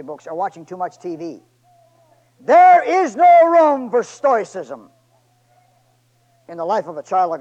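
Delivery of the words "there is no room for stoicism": loud, slow, high preaching cry; full-force preaching. Acted. Preacher thundering at his church, loud and slow. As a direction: hammer the point home.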